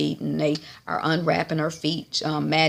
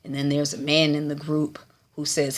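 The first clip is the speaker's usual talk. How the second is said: ordinary talk, nothing marked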